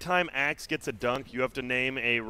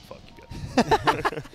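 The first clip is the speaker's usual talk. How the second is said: mock offense; 14 semitones higher, 4 dB louder, much slower than usual. Mock-offended after teasing.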